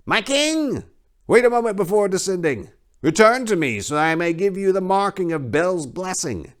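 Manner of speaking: high, loud call from the priest. Acted. Belshazzar calling the king back before he descends, loud and high. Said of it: "Belshazzar called out".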